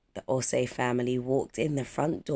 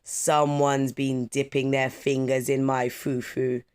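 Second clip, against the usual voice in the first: booming papa voice; 3 dB louder, slower than usual. Acted. Papa Akufo announcing to the family in a slow booming voice. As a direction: boom it out like a proud papa. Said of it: "announced in a booming voice".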